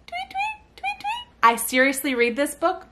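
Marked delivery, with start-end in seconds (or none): 0.1-1.3 tiny, very high soft tweets; baby bird. Shown as a demonstration. Mother answers as the baby bird in the story, tiny chirp. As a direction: squeak it softly like a little chick.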